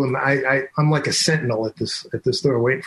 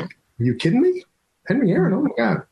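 mock gasping disbelief, higher; imitating starstruck staff seeing a legend; 9 semitones higher than usual. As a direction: play the awed coworkers. Coworkers starstruck by Aaron.

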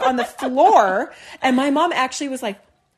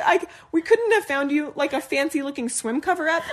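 ordinary talk, nothing marked

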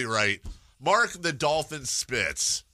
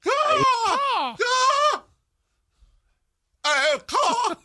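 loud, high cawing bird cry; high crow caw; 6 dB louder, much slower than usual. Pretend. Host cawing loudly for the falcon team.

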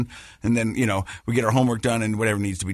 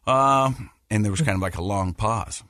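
ordinary delivery

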